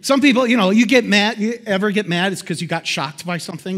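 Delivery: ordinary talk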